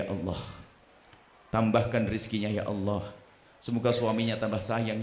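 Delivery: low, soft pleading prayer voice; mock prayer. Preacher acting a worshipper praying for a neighbour, soft and pleading. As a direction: pray it humbly and low.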